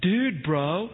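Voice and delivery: lightly imitated Texas college-bro accent, slow; a drawn-out Texas A&M bro accent. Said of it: "with this classic Texas A and M accent".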